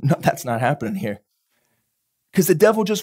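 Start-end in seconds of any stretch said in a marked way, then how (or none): 0.0-1.2 much lower, quietly firm; calm refusal. Retold or imitated. Preacher voicing the anointed rejecting the devil, low and firm. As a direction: shut it down without raising the voice. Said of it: "he's just says".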